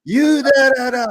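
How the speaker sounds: high, loud mock yell of an angry woman. Woman yelling at the speaker in a parking lot, high angry mock yell. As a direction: shriek at him like a furious stranger. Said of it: "this woman is like".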